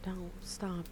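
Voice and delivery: ordinary talk, nothing marked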